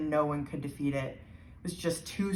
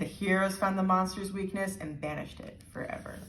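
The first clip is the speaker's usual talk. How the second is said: ordinary delivery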